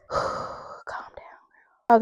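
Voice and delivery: sigh, then whispered aside to self; breathy self-calming. Real, not acted. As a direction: whisper it softly on an exhale. Settling own breath after remark.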